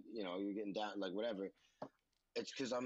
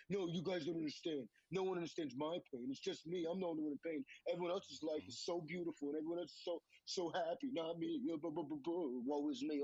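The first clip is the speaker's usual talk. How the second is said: higher-pitched, self-pitying mock whine; mock victim whining; 5 semitones higher than usual. Mocking his old victim mentality with a higher whiny voice. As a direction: play up a sulky woe-is-me voice. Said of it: "All the victim mentality".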